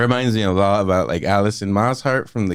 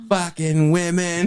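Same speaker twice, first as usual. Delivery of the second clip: high, slow mock disgust; hammed-up resentment; imitated bitterness; 9 semitones higher than usual. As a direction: drag it out as a comic grumble at women. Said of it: "He was mad at all women".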